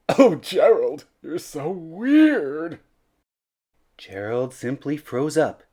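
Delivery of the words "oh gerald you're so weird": loud, high, slow taunt; mocking jeer. A narrator's reading. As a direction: drag it out to mock him. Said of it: "the animals all sneered".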